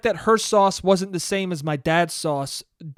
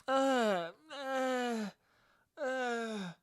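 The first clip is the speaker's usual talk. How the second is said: soft, higher-pitched drawn-out "uh"; disgusted groan; 6 semitones higher, 10 dB quieter, much slower than usual. Retold or imitated. Nine-year-old tasting the syrup at a sleepover, disgusted groan. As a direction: feeble mock groan of distaste, trailing softer.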